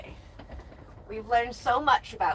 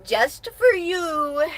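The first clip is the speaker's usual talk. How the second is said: slow, loud sarcastic drawl; mock sarcastic; 6 semitones higher, 5 dB louder, much slower than usual. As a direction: lay on the sarcasm for the trolls. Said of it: "and I hate America".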